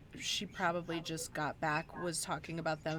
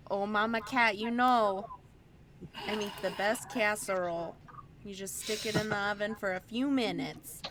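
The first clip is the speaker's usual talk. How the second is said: put-on Midwestern accent; 3 semitones higher, 4 dB louder than usual. Neighbor voiced in story.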